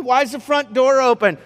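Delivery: dad realizing the door is open; alarmed dad. Acted. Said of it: "so then it's like".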